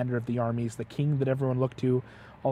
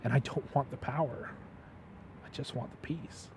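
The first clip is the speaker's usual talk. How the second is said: softly fading to a near whisper; weary longing; 11 dB quieter than usual. Retold or imitated. Retelling a king who just wants peace, weary and hushed. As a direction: let it sink into a hushed, tired wish.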